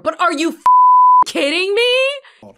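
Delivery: can't-believe-it scream at the film; shocked shriek. Real, not acted. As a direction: shriek it at the screen. Disbelief at the movie scene.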